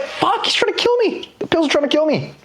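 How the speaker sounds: very high-pitched comic alarm; mock panic. Patient fleeing a sloppy doctor, mock panic. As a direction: squeal it like a panicked patient, hammed up.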